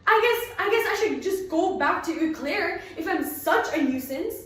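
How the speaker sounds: sulky threat through hurt; wounded teen, voice near breaking; hurt, trembling. Acted. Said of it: "lower lip was trembling violently".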